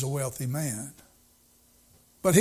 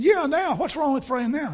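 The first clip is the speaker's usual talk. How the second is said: eager insistence; 10 semitones higher than usual. Retold or imitated. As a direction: press it gladly, right now. Preacher insists on praying now.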